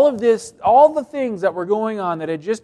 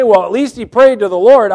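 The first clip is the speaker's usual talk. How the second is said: imitating somebody; 5 semitones higher, 12 dB louder than usual. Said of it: "somebody might say".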